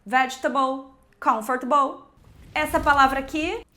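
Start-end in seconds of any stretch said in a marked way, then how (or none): 0.1-0.9 vegetable squeezed to "VEDGE-tbl"; vegetable shortened, ending in a reduced "tbl"; swallowed letters. Shown as a demonstration. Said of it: "de novo". 1.2-2.0 comfortable squeezed to "CUMF-tbl"; comfortable shortened, ending in a reduced "tbl"; swallowed letters. Shown as a demonstration. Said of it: "de novo".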